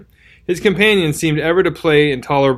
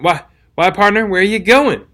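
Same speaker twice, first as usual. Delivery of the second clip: a mildly shaky, higher stammered question; unsteady; 6 semitones higher, 3 dB louder than usual. A narrator's reading. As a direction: stumble over it, a touch unsteady. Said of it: "he asked unsteadily".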